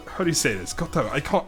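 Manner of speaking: ordinary talk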